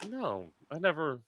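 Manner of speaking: ordinary talk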